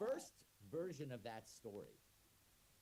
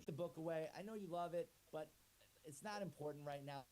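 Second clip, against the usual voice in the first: higher, chummy voice bursting in; overeager pushy friend; 5 semitones higher than usual. Acted. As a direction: barge in all pally and way too keen. Pushy guy interrupts reading.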